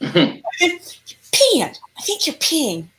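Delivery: high-pitched mock outrage; appalled accusation. Teller catching a man peeing, appalled accusation. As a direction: accuse him in high, comic disgust. Retold disgust at man by fence.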